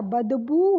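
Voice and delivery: बदबू said slowly with a long final ऊ; correct pronunciation. Shown as a demonstration. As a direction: draw out the ऊ in बदबू. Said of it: "बदब नहीं होता". (बदबू — धीरे, आखिरी ऊ लंबा)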